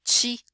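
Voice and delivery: "ti" said as Brazilian chi; "ti" with affricated t, like São Paulo tchi. Shown as a demonstration. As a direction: Paulista chi. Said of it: "nós dizemos".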